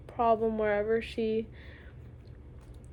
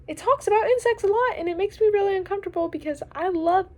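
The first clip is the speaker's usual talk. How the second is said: squeamish rise; 9 semitones higher, 8 dB louder than usual. Real, not acted. Insects make her uncomfortable.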